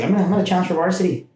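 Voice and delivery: imitating his cocky younger self; fast and keen; eager. Retold eager freshman self.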